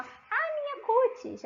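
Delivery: high, slow, affected "Ah, minha cutis"; mock a posh lady admiring her skin; high-pitched posh imitation; posh speaker. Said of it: "As pessoas mais chiques falam".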